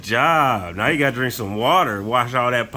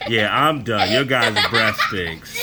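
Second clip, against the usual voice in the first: laughing and groaning; 2 semitones higher, slower than usual. Real, not acted. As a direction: laugh through it, grossed out. Dad laughs at another burp.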